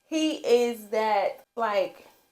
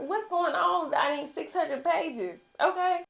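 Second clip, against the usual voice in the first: usual speaking voice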